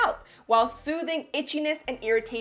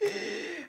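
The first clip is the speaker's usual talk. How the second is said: dramatic gasp; 7 semitones higher, 7 dB quieter than usual. Pretend. Scalp personified, dramatic gasp.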